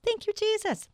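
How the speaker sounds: very high, singsong thanks; high little voice of gratitude; high-pitched praise. Real, not acted. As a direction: lift the thanks right up high.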